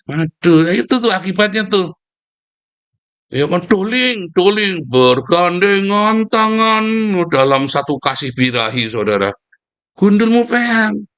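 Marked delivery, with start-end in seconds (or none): 3.3-8.9 mocking sweet talk. Mocking "darling" couples. 9.9-11.1 high, slow, drawn-out jeer; mocking drawn-out jeer; mocking retort.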